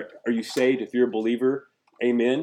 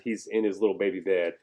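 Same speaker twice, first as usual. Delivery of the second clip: normal talking voice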